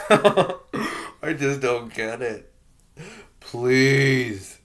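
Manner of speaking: loud frustrated plea; exasperated shout. Real, not acted. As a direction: cry out in disbelief, then beg.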